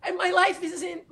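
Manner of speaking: high, teary half-laugh; laughing through tears; real tearfulness. Real, not acted. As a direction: recover from crying with a laugh. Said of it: "I'm crying".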